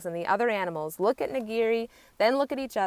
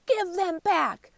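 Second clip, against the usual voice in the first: demanding cry; 12 semitones higher, 4 dB louder, slower than usual. A narrator's reading. Animals demand markings back.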